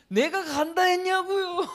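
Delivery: high whiny mock complaint to God; whiny complainer. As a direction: whine at God like a grumbling believer. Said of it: "원망하고 불평해도". (하나님께 투덜대는 높은 징징거림)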